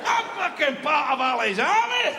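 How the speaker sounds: high yell from a distance; taunting shout. Acted. Little Scotsman boasting to the English army, high yell. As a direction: holler it high like a battle boast. Same hilltop taunting, continued.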